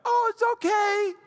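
very high, loud, sing-song reassurance; hammed-up sweetness, high and slow; mock forgiveness. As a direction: switch from cross to syrupy forgiving. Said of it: "She went from angry to".

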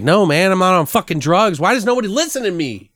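nobody believes him and he's sick of it; exasperated denial. Acted. Kid protesting to the cop that he's not on drugs, exasperated.

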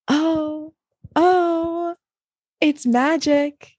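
slow, high awed announcing voice; dramatic announcement. Acted. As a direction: unveil it like a magic trick. Announcing next song dramatically.

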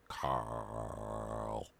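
very low, soft, drawn-out Carl; long shady drawl. Pretend. Lauren throwing shade at Danielle, low drawn-out. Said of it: "giving her one long".

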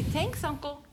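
high coaxing prompt; baby talk. Acted. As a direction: model the words for a toddler. Aunt prompts baby words.

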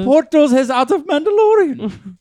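high-pitched mock Cuban; Cuban accent. Cuban spouse back from the bakery, mock Cuban accent. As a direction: complain in a broad Cuban accent. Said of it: "I'm doing a Cuban accent".

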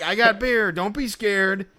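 high, loud, slow coaxing pitch; barking vendor. Retold or imitated. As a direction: coax buyers like a shady hawker. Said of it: "one of the things he goes was like".

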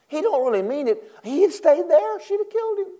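high squeaky emphasis; playful high-pitched aside; comic high aside. Acted. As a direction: ham it up high. Preacher voices high comic aside.

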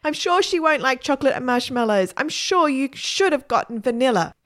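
put-on mum calling after her boy; high, loud call to someone running off. A narrator's reading. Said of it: "his mum calling out behind him".

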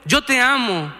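put-on a child silently begging his parents to notice him, pleading; child's inner cry. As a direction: call out to your parents from inside, slowly. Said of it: "está gritando por dentro".

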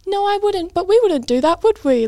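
high-pitched, coaxing host voice; talking down to child. Retold or imitated. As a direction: correct a kid with forced sweetness. Host gently correcting threatening boy.

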